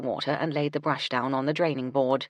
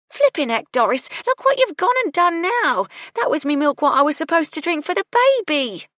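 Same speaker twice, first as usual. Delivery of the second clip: loud, higher telling-off; angry scolding; 12 semitones higher, 9 dB louder than usual. Acted. Gertie scolds Doris for spilling the milk, loud and cross. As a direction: tear into the clumsy maid.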